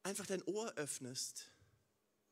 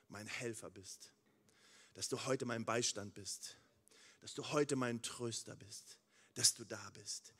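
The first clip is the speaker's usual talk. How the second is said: leise, langsame, innige Gebetsstimme; leises Gebet; 8 semitones lower, 4 dB quieter than usual. Acted. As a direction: sprich das Gebet still und andächtig, mit Pausen. Gebetetes Dankgebet an Gott. (leises, andächtiges Gebet)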